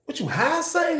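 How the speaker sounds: caught-off-guard high squeak; startled question. Retold or imitated. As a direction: shoot up in disbelief. Reacting to friend's odd state.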